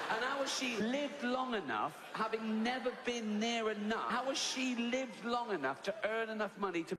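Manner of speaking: high, incredulous comic rant; mock exasperation climbing in pitch; fuming disbelief. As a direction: hammer out the outraged question. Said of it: "I was fuming".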